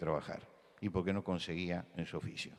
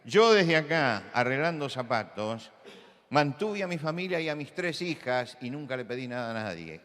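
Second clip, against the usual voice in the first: put-on higher and louder, proud old shoemaker's boast; proud grandfather; 6 semitones higher, 7 dB louder than usual. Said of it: "Él decía". (abuelo zapatero orgulloso, más agudo y fuerte)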